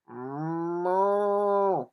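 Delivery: long, loud mooing; cow moo. A narrator's reading. Storyteller mooing as the cow. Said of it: "Then the cow said".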